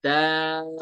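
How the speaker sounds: दाल drawn out long; three-alif elongation. Shown as a demonstration. Teacher reciting बा ईन दाल, दाल stretched. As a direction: hold दाल for three counts. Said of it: "दाल को तीन अलिफ".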